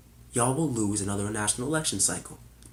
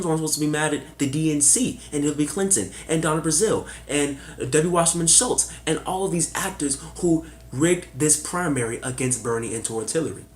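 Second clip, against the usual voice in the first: usual speaking voice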